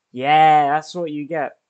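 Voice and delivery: exultant shout of payback; triumphant gloating. Real, not acted. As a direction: crow over a beaten opponent. Gloats after opponent misses in game.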